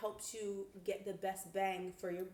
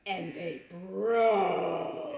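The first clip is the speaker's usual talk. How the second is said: louder, very slow and drawn out; indignant; 2 semitones lower, 10 dB louder, much slower than usual. Real, not acted. Complaining to viewers the guides broke, loud and slow. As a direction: land it hard and slow.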